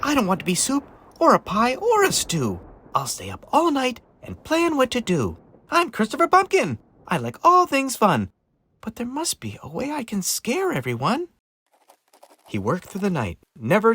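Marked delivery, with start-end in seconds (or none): 0.0-11.3 high, plaintive character voice; small pumpkin fretting, then resolving; worried little pumpkin. Acted. Christopher afraid of becoming soup, high and anxious.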